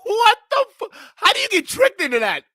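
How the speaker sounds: high, loud, incredulous laughing question; laughing disbelief. Real, not acted. Streamer laughing in shock at the guest's story. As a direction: demand an answer while cracking up.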